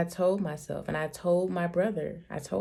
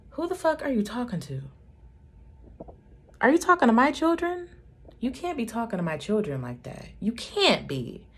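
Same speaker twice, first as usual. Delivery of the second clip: outraged; 6 semitones higher than usual. Retold or imitated. Mother furious at brother for ordering her children. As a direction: bristle like a mother defending her kids.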